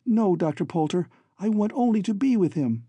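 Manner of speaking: lightly voiced young woman, earnest plea; pleading daughter. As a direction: plead gently as the captive daughter. Captive daughter pleads with villain.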